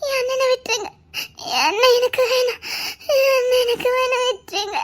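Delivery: high-pitched crying plea; wailing, desperate plea; tearful begging. Acted. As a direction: beg for your brother through sobs. Begging for a brother's release.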